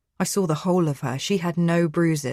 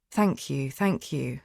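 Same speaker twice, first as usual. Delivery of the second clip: ordinary delivery, nothing marked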